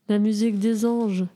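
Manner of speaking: plain reading voice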